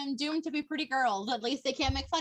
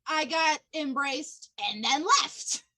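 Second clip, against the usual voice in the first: ordinary delivery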